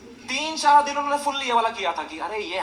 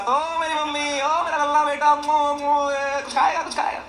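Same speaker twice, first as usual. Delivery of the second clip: put-on higher, loud doting baby talk; doting mom; 5 semitones higher, 4 dB louder than usual. Comedian mimicking a mother fussing over her son with food, in loud doting baby talk.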